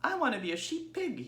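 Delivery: a sweet, well-mannered little pig; polite young pig. Acted. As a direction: Babe greeting the sheep, high and polite. Babe's voice.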